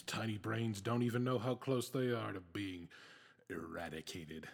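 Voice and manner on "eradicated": sinister whisper. Acted. Villain gloating.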